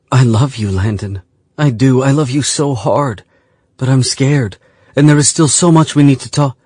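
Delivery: rising, tearful declaration; emotional confession. Acted. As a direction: pour out love and fear.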